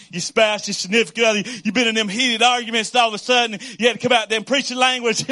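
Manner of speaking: ordinary delivery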